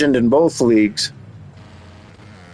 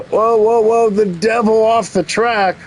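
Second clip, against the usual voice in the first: surprised whoa; 7 semitones higher than usual. Real, not acted. Rider leaves the track.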